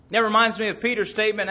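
ordinary talk

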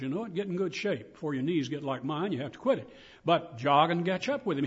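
normal talking voice

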